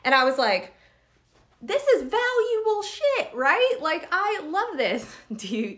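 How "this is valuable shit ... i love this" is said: high, slow, gushing delight; gushing excitement. Retold or imitated. To her audience, recalling Natasha's lesson: high, slow gushing delight. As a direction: swoon over how valuable it is.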